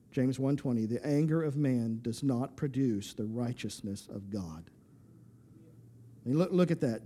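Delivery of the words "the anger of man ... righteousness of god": soft slow reading. Acted. Scripture read slowly and softly.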